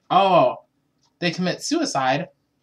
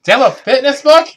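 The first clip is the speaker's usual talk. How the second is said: high loud mock question; 10 semitones higher, 12 dB louder, faster than usual. Third escalated take.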